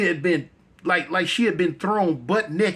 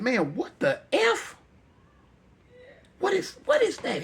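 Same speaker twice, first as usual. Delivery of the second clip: startled; 12 semitones higher than usual. Acted. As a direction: gasp out at the strange light. Steve startled by light.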